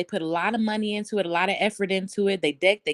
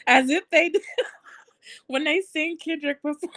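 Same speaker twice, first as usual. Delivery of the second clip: high-pitched, breaking up laughing; real giggles cutting into the sentence; talking through laughter; 8 semitones higher than usual. Real, not acted. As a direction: try to get the words out while cracking up. Cracking up while mocking fans.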